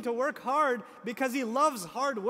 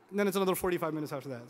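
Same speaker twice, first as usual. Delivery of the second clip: usual speaking voice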